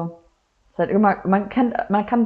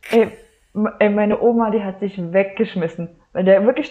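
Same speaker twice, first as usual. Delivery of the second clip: usual speaking voice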